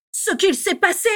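high, loud outburst; explosive anger. A narrator's reading. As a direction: explode in indignant disbelief. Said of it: "explosa Bellatrix". (explosion de colère indignée)

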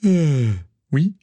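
hesitant, drawn-out admission; confessing. A narrator's reading. Natalia admitting to Gaël she bet, sheepish. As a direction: own up with a long hesitation. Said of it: "avoua Natalia".

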